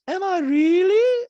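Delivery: loud, high, slow comeback; Diana. Retold or imitated. Diana answering Sutton's jab, high loud impression. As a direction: mimic her disbelief way up high. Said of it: "Don goes".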